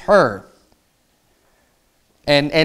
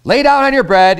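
eager friend's voice, higher, louder and faster; scheming friend; 5 semitones higher, 4 dB louder than usual. Retold or imitated. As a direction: sell the wicked plan as a helpful pal. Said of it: "He's saying".

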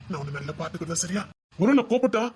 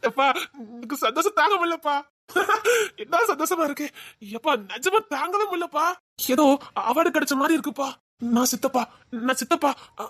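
overjoyed, laughing; 6 semitones higher than usual. Acted. New uncle overwhelmed with joy.

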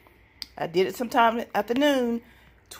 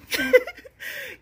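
a much higher giggling laugh; high burst of laughter. Real, not acted. Vlogger laughing at her own yelling, high laugh. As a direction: laugh at yourself, high and bright.

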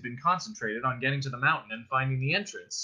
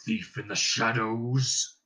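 slow, booming dragon boast; gloating dragon; much slower than usual. Acted. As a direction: savour every threat as you gloat. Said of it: "he gloated".

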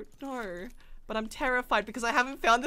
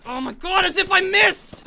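frustrated outburst; 6 semitones higher, 7 dB louder, slower than usual. Real, not acted. Misses a shot.

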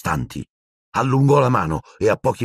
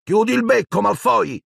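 snapping retort; 13 semitones higher than usual. Acted. Parvati angrily snaps at Malfoy to shut up. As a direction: snap back at the bully. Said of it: "sbottò Parvati Patil".

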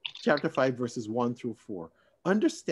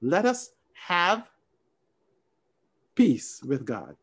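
very slow, each word laid out; slow reading; much slower than usual. Shown as a demonstration. Preacher reading the other translation aloud, slow and deliberate. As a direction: read it slowly as the new translation. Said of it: "read it the way another translation would write".

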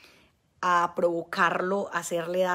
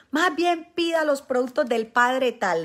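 mock demanding husband; 5 semitones higher, 6 dB louder, slower than usual. Husband ordering.

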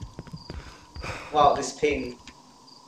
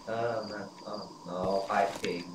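ordinary talk

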